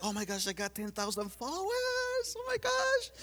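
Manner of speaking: soft, then a very high squeal on the last gosh; breathless mock glee; imitating a gushing friend. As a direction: mimic a friend bragging about followers. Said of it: "she was like".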